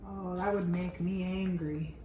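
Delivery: ordinary delivery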